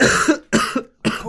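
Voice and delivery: loud, harsh hacking cough. Real, not acted. Coughing fit mid-question about testicles, loud hack.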